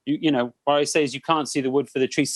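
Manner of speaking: ordinary delivery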